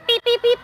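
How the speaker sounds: high playful beep beep like a reversing truck. Pretend. Coach playfully backing a gymnast into place, high beeps.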